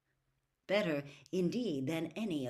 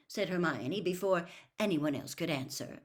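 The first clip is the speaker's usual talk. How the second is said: ordinary delivery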